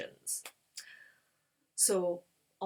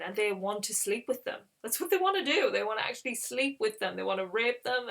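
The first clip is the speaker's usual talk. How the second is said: ordinary talk, nothing marked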